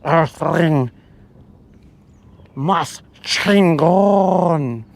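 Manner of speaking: very slow alien pronouncement. Retold or imitated. Imagined alien praising Earthlings, slow alien voice. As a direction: let each word hang like a visitor from space. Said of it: "And he's like".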